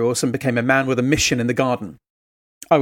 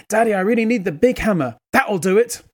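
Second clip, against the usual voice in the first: put-on higher, childlike small-boy voice; a young child's voice, higher pitched; little boy; 7 semitones higher than usual. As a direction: play the toddler complaining to Daddy. Small son voiced in story.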